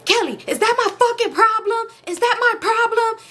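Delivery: high-pitched imitated outburst; angry yelling. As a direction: mimic him blowing up at her. Retold angry demand for money.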